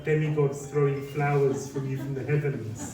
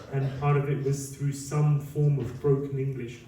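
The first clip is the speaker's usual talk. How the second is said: ordinary delivery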